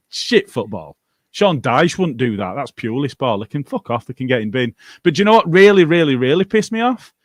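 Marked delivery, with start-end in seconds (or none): 5.0-7.1 louder build to the grievance; angry rant. Real, not acted. Host ranting about Newcastle fielding a drink driver, louder and angry. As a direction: push hard into the anger. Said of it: "the Auto No Better rant".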